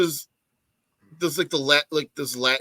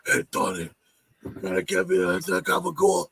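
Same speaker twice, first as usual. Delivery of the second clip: hushed, mock Italian-American whisper; Italian-American relative; 3 semitones higher than usual. Joking that the ghost was an Italian relative, hushed mock Italian-American whisper. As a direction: whisper it like a nonna from New Jersey wanting cured meat. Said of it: "one of your Italian family members being like".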